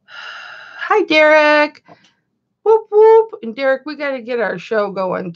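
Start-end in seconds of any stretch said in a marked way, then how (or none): 0.0-1.8 high sing-song hi. Real, not acted. Greeting a viewer warmly. 2.6-3.4 high, loud little cheer; excited whoop. Real, not acted. Greeting Derek in the chat with a loud happy whoop.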